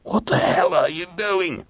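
high, strained croak; strangled, high-pitched disbelief; croaked outrage. A narrator's reading. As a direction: croak out an alarmed demand. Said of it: "Hiram croaked".

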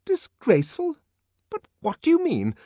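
very high, loud, indignant nurse; outraged disbelief; intense. Acted. Nurse arguing back at savage.

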